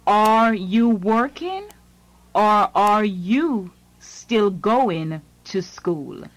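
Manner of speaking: ordinary talk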